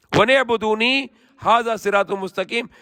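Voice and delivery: swift, even Quranic recitation. Acted. Preacher reciting the verse to listeners in quick chant.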